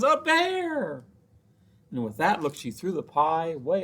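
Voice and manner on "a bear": high startled shriek; startled cry. A narrator's reading. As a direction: gasp it out in shock. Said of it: "She says".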